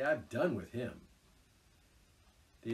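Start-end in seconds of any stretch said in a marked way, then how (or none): 0.0-1.0 savage. A narrator's reading. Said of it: "said the rat savagely".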